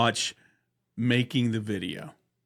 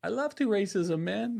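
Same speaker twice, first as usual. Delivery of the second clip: higher mock broken English; Eastbound character voice; 8 semitones higher than usual. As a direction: quote him squeaky and proud. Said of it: "He said".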